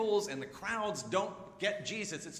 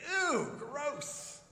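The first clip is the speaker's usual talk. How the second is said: high, loud disgusted ew; mock disgust; 12 semitones higher, 5 dB louder than usual. Crowds hearing about eating flesh, a grossed-out squeal.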